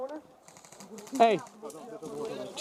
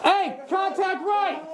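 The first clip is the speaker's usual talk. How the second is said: shouting; 8 semitones higher, much slower than usual. Real, not acted. Calling out enemy contact.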